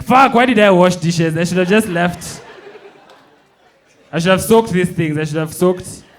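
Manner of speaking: loud, high, frustrated regret; exasperated yell. Acted. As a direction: yell it in disbelief. Dying man regretting washing dishes.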